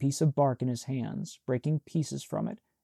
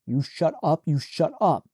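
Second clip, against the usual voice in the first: louder, pressing each repeat; loud repeated order; 3 semitones higher, 5 dB louder than usual. Acted. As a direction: lose your temper at her. Shaking his sister, losing control.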